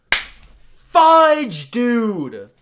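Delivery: sudden very loud high yell of frustration; real outburst. Real, not acted. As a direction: blurt it out as a startled furious shout. Sudden outburst at failed catch.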